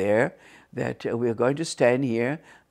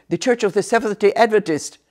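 loud, high, eager blurt; acted interruption; 8 semitones higher, 6 dB louder than usual. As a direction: cut in with a raised voice. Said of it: "interrupt me".

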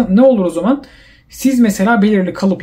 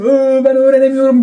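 mock whining; 6 semitones higher, 3 dB louder than usual. Discouraged student wailing about not learning, mock whining. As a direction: imitate a defeated learner's complaint.

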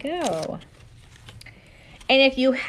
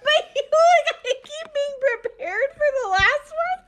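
high, laughing complaint; laughing protest. Real, not acted. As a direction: protest while cracking up. Laughing protest at word game.

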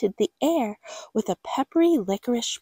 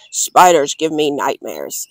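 low, louder grumpy dad; gruff father; 8 semitones lower, 5 dB louder than usual. Acted. Father refusing spiders, low gruff grumble. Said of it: "said Father".

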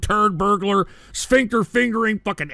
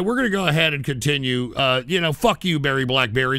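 ordinary talk

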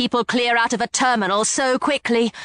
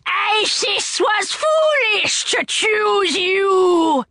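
monster yelling; 7 semitones higher, much slower than usual. A narrator's reading. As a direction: spit out contempt at the chosen girl. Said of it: "Neckbet yelled".

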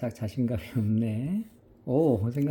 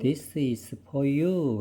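very slow, clear this is for you, falling on you; slow model phrase; 2 semitones higher, much slower than usual. Shown as a demonstration. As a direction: model this is for you slowly for the child. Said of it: "이렇게 하면 돼요".

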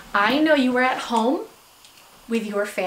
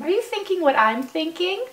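ordinary delivery

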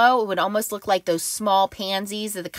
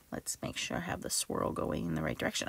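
soft whisper to herself while checking; whispered aside; 3 semitones lower, 14 dB quieter, faster than usual. Real, not acted. Crafter whispering to herself while lining up die, whispered. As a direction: whisper it under your breath, focused.

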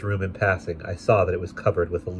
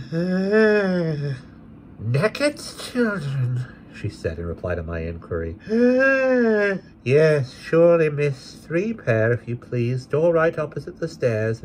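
put-on good-natured old woman; 9 semitones higher, slower than usual. Asthmatic old woman answers.

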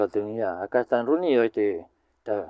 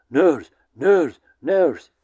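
loud yell of "Nurse", each one hit hard; shouted nurse; 3 semitones higher, 5 dB louder, much slower than usual. Retold or imitated. A student yelling at the newcomers, loud shout. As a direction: bark the word out like a rowdy student. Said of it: "este sujeto que les grita".

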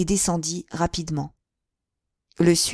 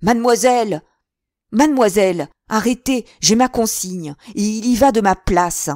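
loud, high calling out; shouting for help; 4 semitones higher, 7 dB louder than usual. A narrator's reading. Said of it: "criant de toutes ses forces".

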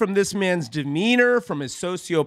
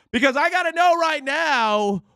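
hyped-up build; 7 semitones higher than usual. Acted. Hyping up the intro song.

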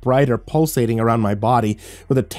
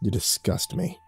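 cold disgust; 8 dB quieter than usual. Acted. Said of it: "he said".

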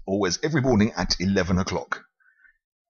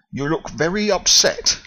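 concerned foreman; 9 semitones higher, 3 dB louder than usual. Acted. Foreman voiced.

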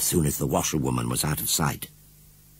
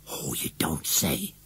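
slow, softer whispered gasp; 3 semitones lower, 9 dB quieter, slower than usual. Acted. Missus Braithwaite whispering back to the washerwoman. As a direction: breathe it out in awe.

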